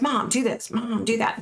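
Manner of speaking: high-pitched mock yelling; Britney. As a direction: imitate bratty orders to mother, no added volume. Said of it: "screaming. And she's like".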